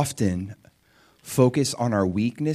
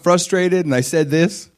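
pleading; 8 semitones higher, 8 dB louder than usual. Pretend. Preacher voicing self-doubt to Jesus.

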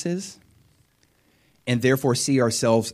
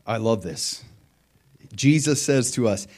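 ordinary delivery

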